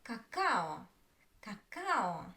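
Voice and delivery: ka-KA-o, middle "a" of "cacao" held longer; short first syllable, long second in "cacao"; stress on second syllable. Shown as a demonstration. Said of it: "the second".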